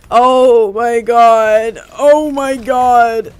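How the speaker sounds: long, drawn-out loud cry; loud dismayed wail. Real, not acted. Player watching the game go wrong, loud dismayed wail. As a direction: stretch each word out in loud disbelief. Knocked off the battle bus.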